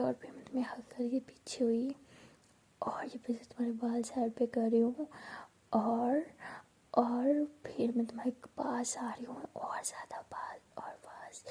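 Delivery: hushed, intimate whisper; soft whisper. Acted. Bhavna teasing Piyu with a pretend romance, soft whisper. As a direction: breathe it close like a secret.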